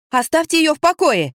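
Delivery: high, ringing indignant protest. A narrator's reading. Narrator defending a girl from her mother, indignant. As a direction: raise the pitch and demand it. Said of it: "возмутилась я".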